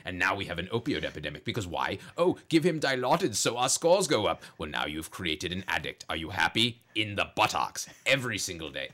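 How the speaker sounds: low Darth Vader rant, rising to angry bursts; Doc Vader rant. Acted. Doctor in Vader voice rants about patient surveys and opioids. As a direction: rant like a masked space villain doctor. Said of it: "he comes out and he's like".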